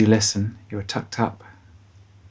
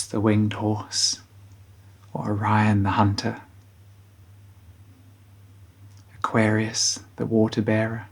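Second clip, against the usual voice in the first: guided bedtime meditation. Acted. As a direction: guide children gently towards sleep, unhurried.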